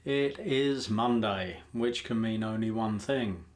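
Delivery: playful tannoy-style proclamation; mock announcer. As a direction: call all personnel to attention in fun. Mock announcement opening the recording.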